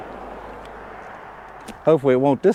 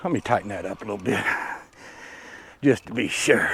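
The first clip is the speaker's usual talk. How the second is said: muttering; 8 semitones lower, 9 dB quieter than usual. Real, not acted. Fixing the camera, muttering.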